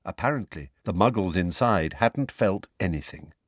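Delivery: usual speaking voice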